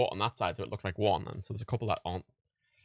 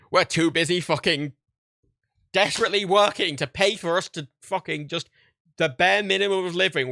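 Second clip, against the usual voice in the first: loud, exasperated political tirade; genuinely furious rant; 9 dB louder than usual. Real, not acted. Vlogger ranting to viewers about overworked Americans, genuinely furious. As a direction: hammer it out, outraged and much louder. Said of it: "I hope you can hear it in my voice".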